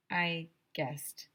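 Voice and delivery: ordinary delivery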